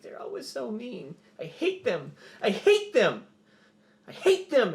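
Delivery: put-on high child's voice building to loud outbursts; crying young kid. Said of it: "he looks up and he's still crying".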